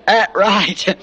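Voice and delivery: put-on chuckling through a short old-chief reply; a hint of the terse old Indian with a laugh; lightly voiced old Indian, laughing. Preacher quoting the chief while laughing. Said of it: "this old Indian".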